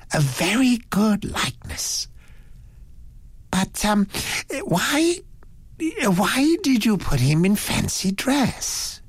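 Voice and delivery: high, hesitant gentleman's voice; high-pitched, halting curiosity; puzzled Mister Satterthwaite. A narrator's reading. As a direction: puzzle over it in a high voice, stumbling into the question. Voices Satterthwaite questioning the drawing.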